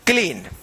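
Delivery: क्लीन alone, long "ee", falling tone; model reading of clean. Shown as a demonstration. Teacher drills the class on reading it, a falling, long-voweled क्लीन. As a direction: repeat क्लीन as a clear drill answer. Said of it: "क्या पढ़ेंगे?".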